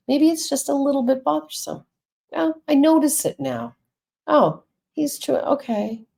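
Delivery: high, small voice easing off; little annoyance person. Acted. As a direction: voice a tiny emotion calming down, pitched well up.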